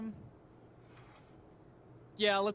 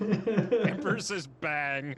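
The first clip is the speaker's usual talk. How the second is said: mock decree; 5 semitones higher, 6 dB louder, much slower than usual. Player mock-decrees with laughter.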